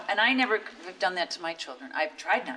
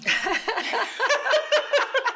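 loud real laugh; high burst of laughter; 4 dB louder than usual. Real, not acted. Laughing at the joke.

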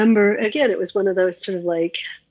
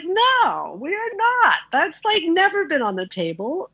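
shrill-pitched "no" to an intruder, a mild mock yell; retold yelling; 10 semitones higher than usual. To a coworker in her room at night, high indignant yell. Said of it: "I just started yelling at him".

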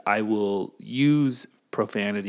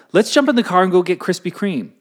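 excited suggestion; 9 semitones higher, 8 dB louder than usual. Pretend.

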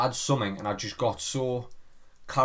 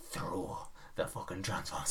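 low inner urge; 6 semitones lower, 13 dB quieter than usual. Retold or imitated. Impulse voice urging throw.

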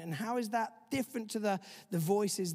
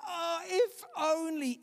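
much higher, slow mournful wail; wailing lament; 10 semitones higher, slower than usual. Retold or imitated. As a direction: drag out the sigh of regret. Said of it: "It energizes regret".

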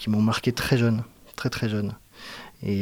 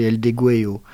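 self-mocked Spanish accent; 6 dB louder than usual. Shown as a demonstration. Guest naming a Mexican song in his shaky Spanish accent. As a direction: try the Spanish, knowing it comes out rough. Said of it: "Alors mon accent espagnol est pourri".

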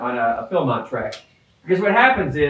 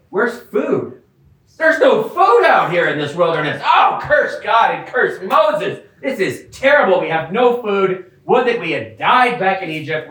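hungry whining; 2 semitones higher than usual. Retold or imitated. Hungry Israelites in the wilderness, hammed-up whining lament. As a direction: whine dramatically about no food. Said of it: "they're like".

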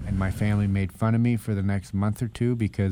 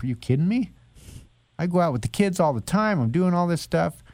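high, incredulous protest; retold indignant pushback to a wife; indignant denial; 9 semitones higher than usual. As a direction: defend yourself in a higher, disbelieving voice. Protesting wife's depression remark.

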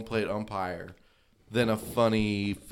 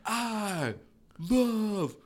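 mock nostalgic ode; 8 semitones higher than usual. Mock wistful tribute.

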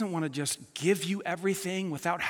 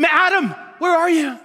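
calling out; 8 semitones higher, 8 dB louder than usual. Retold or imitated. God calling out in the garden.

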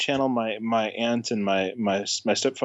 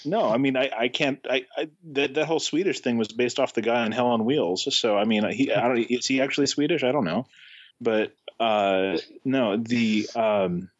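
ordinary talk

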